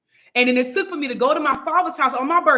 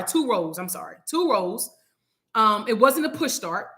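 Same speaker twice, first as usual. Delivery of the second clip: normal talking voice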